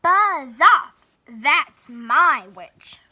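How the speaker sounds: very loud, slow, monstrous growl; terrible beast voice. A narrator's reading. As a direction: snarl it to scare off the dragon. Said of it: "its terrible voice".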